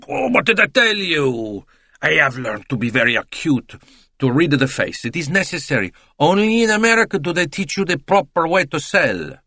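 put-on high, exclaiming Italian accent; excitable, boastful Italian salesman; triumphant Italian. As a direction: crow that you knew it, Italian style.

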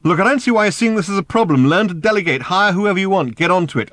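high, loud impatient urging. Acted. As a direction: push them briskly to get moving. Ford pressing room service.

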